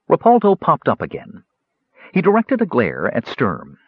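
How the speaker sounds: plain reading voice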